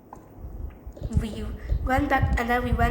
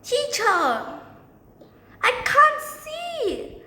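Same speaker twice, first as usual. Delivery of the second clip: very high, drawn-out shout; hammed-up pupil calling out; student's yell; 13 semitones higher, 4 dB louder, slower than usual. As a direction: yell slowly in a shrieking mock complaint.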